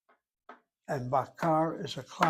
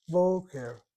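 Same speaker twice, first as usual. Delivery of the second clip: boker with BO higher and louder; stress on first syllable; 4 semitones higher, 4 dB louder than usual. Shown as a demonstration. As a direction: punch the BO in boker. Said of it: "The emphasis in this case ... is over that first syllable".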